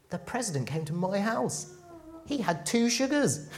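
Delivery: high-pitched, awestruck boast to friends; excited bragging. Acted. Neighbour telling all their friends about the president's visit, excited bragging. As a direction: gush about it, drawing the words out.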